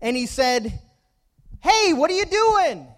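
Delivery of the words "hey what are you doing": high, loud indignant yell; acted angry holler; boy getting mad. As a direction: shout it like a cross little boy. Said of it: "started to get mad".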